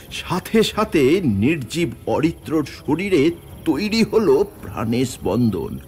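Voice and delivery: plain reading voice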